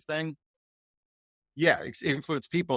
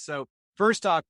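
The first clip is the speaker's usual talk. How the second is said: ordinary talk, nothing marked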